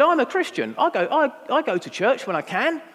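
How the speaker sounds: brazen, high-pitched declaration; Chad. Retold or imitated. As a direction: proudly announce it, pitch well up. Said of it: "brazen character standing there in this bar".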